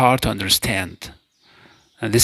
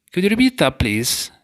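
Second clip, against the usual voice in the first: a higher, eager request; bright high ask; 8 semitones higher than usual. Shown as a demonstration. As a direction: lift the pitch high on repeat. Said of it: "If you'd like someone to say a word ... again".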